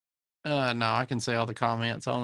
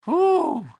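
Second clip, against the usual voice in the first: high whistling whew; 5 dB louder than usual. Real, not acted. Reacts to negative degrees.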